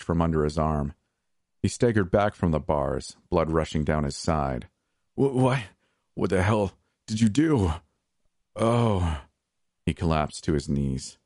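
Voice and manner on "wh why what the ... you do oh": high, weak shocked gasps; dying confusion. A narrator's reading. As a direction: fade in disbelief. Dying man bleeding out.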